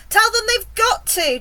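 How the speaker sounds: high, loud insistence; urgent shouted reply. A narrator's reading. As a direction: shout back, allowing no refusal. James answers the yelling, urgent.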